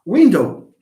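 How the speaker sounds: "Window" with WIN punched loud, dow weak; stress on first syllable. Shown as a demonstration. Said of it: "Do we say window".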